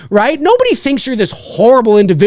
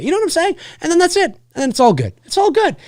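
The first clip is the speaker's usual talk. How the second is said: usual speaking voice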